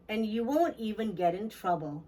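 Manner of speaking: usual speaking voice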